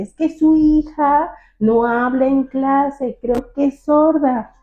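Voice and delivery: más alto, lento y agudo, como a alguien que no oye; maestra en voz alta. Retold or imitated. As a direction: imitando a la maestra que habla fuerte y despacio. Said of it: "le hablaba en voz alta". (la maestra le habla fuerte y lento a la mamá sorda)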